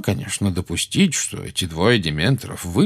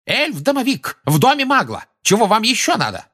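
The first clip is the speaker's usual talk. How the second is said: very high, exasperated outburst; scandalised official, voice shooting up; indignant roar; 5 dB louder than usual. A narrator's reading. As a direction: demand it loudly of the whole room. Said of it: "взревел Фадж".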